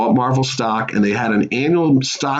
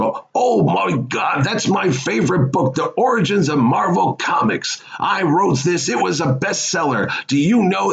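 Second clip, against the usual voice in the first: lightly voiced beaming Stan Lee, proud of his book; 7 semitones higher than usual. Comic legend tells young fan about his bestseller, delighted.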